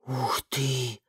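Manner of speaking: soft, low awed breath; breathed out wow. A narrator's reading. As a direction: breathe it out in awe. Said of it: "выдохнула Бренда".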